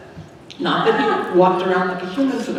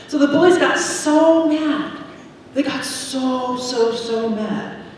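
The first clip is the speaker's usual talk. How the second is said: put-on dramatic emphasis for the kids; emphatic build; 6 semitones higher, slower than usual. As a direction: lean hard on bullies, then drag out so mad. Stressing how angry the bullies were.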